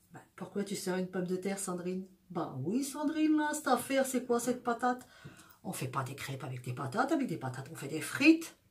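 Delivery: put-on low, soft Belgian French accent; put-on Belgian accent, kept low. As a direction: play a mock dialogue in a Belgian accent. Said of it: "On fait bien l'accent belge".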